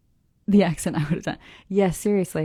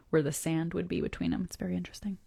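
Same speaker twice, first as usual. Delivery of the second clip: plain reading voice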